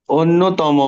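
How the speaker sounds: অন্যতম said loud and clear, drawing out the doubled ন; teacher sounds out অন্যতম for the class to copy; model pronunciation. Shown as a demonstration. Teacher modelling অন্যতম loudly for students to repeat. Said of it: "দেখো".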